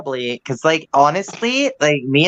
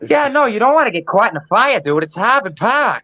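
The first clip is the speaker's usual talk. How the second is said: higher Boston tough guy accent; 8 semitones higher than usual. Shown as a demonstration. Doing his Boston accent for the hosts. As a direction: play a brash Boston local. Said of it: "Oh, Boston now".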